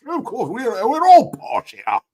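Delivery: high, hammed-up British toff; posh British. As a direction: crow it in a mock posh British accent, rising loud. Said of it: "It sounds very posh".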